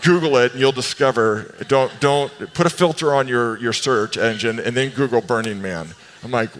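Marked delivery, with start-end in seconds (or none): none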